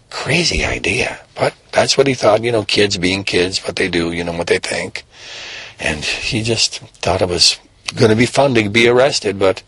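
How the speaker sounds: plain reading voice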